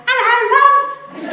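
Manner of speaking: high, loud, drawn-out greeting; exaggerated impression of an exuberant old friend; imitated hearty old man. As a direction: call it out like a chirpy ninety-year-old. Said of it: "'Cause that's how he speaks".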